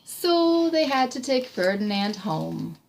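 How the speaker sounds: soft bedtime-story finish; gentle story ending. A narrator's reading. Reads story aloud to child.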